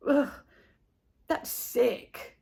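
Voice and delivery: breathy, disgusted with face scrunched. A narrator's reading. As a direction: grimace as you say it. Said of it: "scrunching his face".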